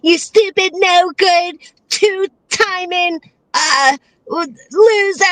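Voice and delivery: lightly voiced mock rant; mocking her rant. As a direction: parrot her tirade to ridicule it. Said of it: "her fucking voice and everything".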